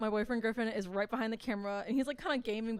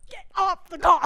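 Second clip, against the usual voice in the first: much louder and higher urgent shout; urgent yell; 9 semitones higher, 15 dB louder than usual. Retold or imitated. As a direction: bark the order to hang up now. Boyfriend sprinting in, alarmed.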